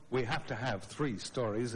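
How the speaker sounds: ordinary delivery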